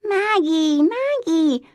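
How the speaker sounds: put-on high, loud little child calling; toddler voice. A narrator's reading. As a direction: call out like an excited toddler. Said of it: "said the toddler".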